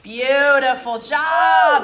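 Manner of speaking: loud, slow stretched-out cheer; excited praise. Real, not acted. Teacher praises child's finished move.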